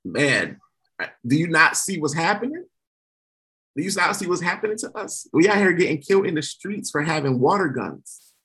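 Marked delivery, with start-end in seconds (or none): 1.2-8.3 earnest insistence. Real, not acted. Pressing the point earnestly.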